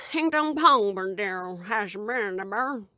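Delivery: slurred, drawn-out garbled bandit speech; slow unintelligible backwoods mumble; Jimbo Hodunk. Acted. Quoting a bandit clansman's last words, slow garbled mumble. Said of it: "something Jimbo Hodunk told me".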